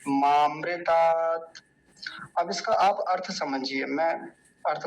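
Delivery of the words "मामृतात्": मामृतात् very slow, louder, each syllable set apart; careful recitation. Shown as a demonstration. Teacher drilling the right form, slow and loud. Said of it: "ऐसे नहीं बोलना".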